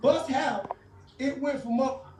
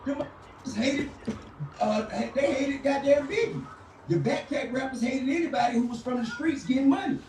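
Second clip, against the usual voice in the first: retold quote; 7 semitones higher than usual. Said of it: "He was like".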